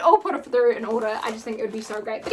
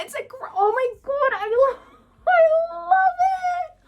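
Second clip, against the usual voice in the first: delighted squeal; 4 dB louder than usual. Real, not acted. Gushing over handcrafted plush.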